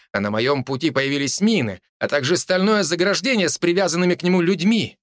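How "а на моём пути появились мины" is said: plain reading voice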